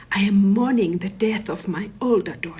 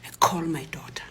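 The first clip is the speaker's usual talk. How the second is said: usual speaking voice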